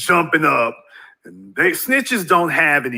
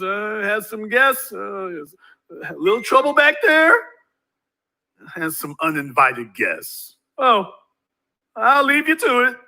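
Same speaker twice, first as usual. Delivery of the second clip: mock nervous cop; 2 semitones higher than usual. Cop at John Wick's door, nervous mock cop voice. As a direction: play the cop backing off nervously. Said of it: "The cop looks behind him and says".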